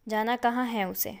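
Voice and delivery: ordinary delivery, nothing marked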